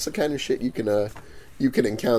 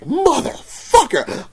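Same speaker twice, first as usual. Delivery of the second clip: high, drawn-out retold curse; mad; 12 semitones higher, much slower than usual. Vendor after customer walked away, stretched-out mad curse. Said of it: "I was so mad".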